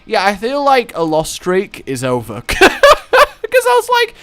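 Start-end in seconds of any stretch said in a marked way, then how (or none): 2.5-4.1 high laughing burst. Real, not acted. Recalling viewers' dare while laughing high. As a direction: laugh out the words.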